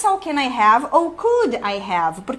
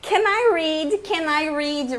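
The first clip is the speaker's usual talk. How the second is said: polite request; 4 semitones higher, much slower than usual. Shown as a demonstration. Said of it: "não precisa ser assim".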